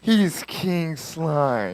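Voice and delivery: slow, hushed villain whisper; anime villain voice. Retold or imitated. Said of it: "making him sound like an anime villain".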